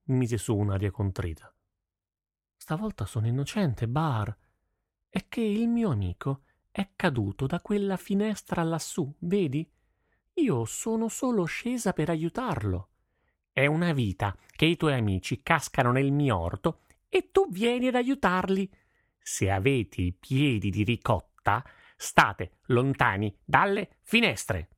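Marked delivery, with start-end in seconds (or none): 2.6-12.9 put-on high, innocent girl's voice; girl feigning innocence to the farmer; young girl pleading innocence. 13.5-24.7 put-on an old peasant fuming over his ruined vegetables; angry old farmer. Farmer voiced again.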